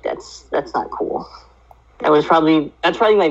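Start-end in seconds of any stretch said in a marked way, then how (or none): 0.0-1.3 whispered. Retold or imitated. Retold own reaction to ending.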